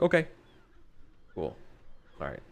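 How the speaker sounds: short, separated replies; laconic. Acted. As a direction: acknowledge it in as few words as possible. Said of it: "almost like, like, laconic".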